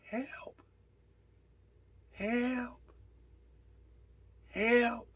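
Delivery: high, soft cries for help from far away; faint far-off call. Retold or imitated. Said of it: "It's so faint".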